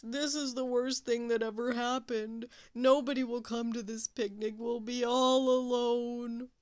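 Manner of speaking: mopey groan; groaning Walrus. Acted. Walrus lamenting.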